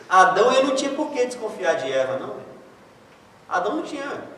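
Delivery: usual speaking voice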